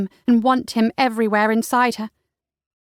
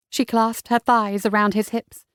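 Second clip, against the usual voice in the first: ordinary delivery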